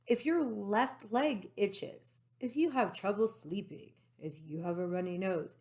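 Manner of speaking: usual speaking voice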